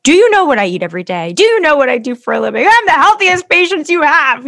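fast, loud, rising indignant protest; defensive. Retold or imitated. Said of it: "getting so defensive".

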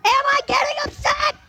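high furious shouting. Acted. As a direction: scream it at him. Wife explodes at husband.

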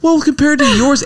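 squeaky high teasing comeback; mock high retort. Husband mimicking wife's comparison, squeaky. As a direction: jump way up to tease.

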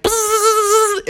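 high-pitched insect buzz; flying ants buzzing. Acted. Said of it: "and they were like".

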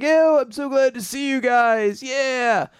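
super excited, high-pitched shouty greeting; overjoyed cheering in a high put-on voice; Asta. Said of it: "Asta's super excited".

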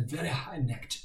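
ordinary delivery

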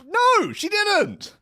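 very high, slow, loud no; shrill shout. Acted. Elon snapping back at his wife, a very high slow shout. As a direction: shriek the denial.

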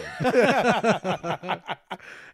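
high, loud burst of laughter; real laughter. Real, not acted. Comics amused by the lisp demo, real high laughter. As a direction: crack up in a long rattling laugh.

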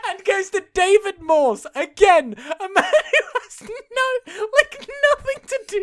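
high, laughing disbelief; laughing hard. Real, not acted. Friend recounting the absurd airport scene, laughing hard. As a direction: crack up while telling it.

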